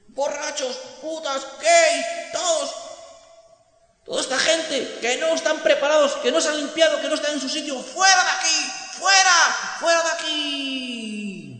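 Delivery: very high, loud shouting; crowd shouting. Acted. Crowd shouting to drive the outcasts out. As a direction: yell it in a frenzy. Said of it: "Y todos decían".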